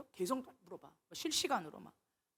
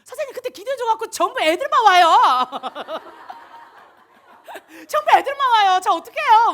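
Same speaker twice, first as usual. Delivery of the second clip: excited student quote; 10 semitones higher, 18 dB louder than usual. Retold or imitated. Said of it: "저한테 쫓아와가지고 얘기했잖아요".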